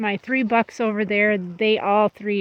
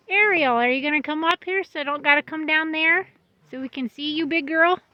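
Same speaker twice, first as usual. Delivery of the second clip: higher, louder call up to the goat; 7 semitones higher, 3 dB louder than usual. Real, not acted. Owner calling the pregnant doe Ariel to come up, raised higher call.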